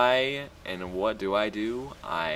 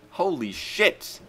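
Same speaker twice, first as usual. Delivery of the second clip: slow, much higher holy shit; stunned exclamation; 9 semitones higher, slower than usual. Real, not acted. Gamer amazed at his win, slow astonished cry. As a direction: let the disbelief stretch out. Reacting to the fight.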